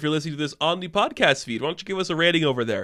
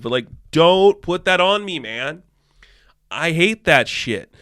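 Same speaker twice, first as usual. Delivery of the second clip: ordinary talk, nothing marked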